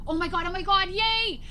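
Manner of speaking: high-pitched elated cheer; excited. Retold or imitated. Parent watching kids' soccer game bridge, excited. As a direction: cheer in giddy, high delight. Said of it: "Why are you so excited?".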